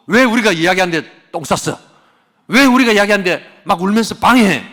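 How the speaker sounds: loud, indignant pretend scolding; mock angry scolding. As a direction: bark it like an annoyed grown-up, tongue in cheek. Imagined adults scolding the baby. (대화 방해한 아기를 꾸짖는 흉내, 크게 짜증 내듯)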